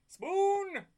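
high, loud superhero shout; Tick battle cry. Retold or imitated. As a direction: bellow it like the Tick. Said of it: "without us going".

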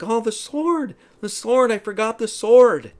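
very high, loud mock dismay; acted panic. Arthur realizing he forgot Kay's sword, acted panic. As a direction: wail it like a flustered boy.

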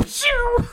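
high imitated whoosh of a launch; Dragon Ball blast. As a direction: make a high-pitched anime take-off noise. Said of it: "Dragon Balls".

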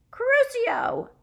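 very high loud scream. A narrator's reading. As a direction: shriek the curse. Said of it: "She screamed".

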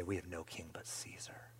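very soft whisper; whispered declaration. Retold or imitated. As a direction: barely breathe the words. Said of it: "And they say".

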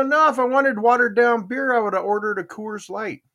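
sarcastic retort. Retold or imitated. Retelling his comeback to the bartender, mock-indignant. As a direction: toss off the snobby beer joke.

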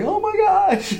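very high, giddy squeal; mock overwhelmed amazement. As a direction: replay the thrill in a squeaky high voice. Retold amazement at getting a ticket.

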